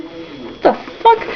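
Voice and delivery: soft, slow baffled aside; disbelieving mutter. Real, not acted. Reader baffled by the plot, soft slow disbelief. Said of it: "reader baffled by story".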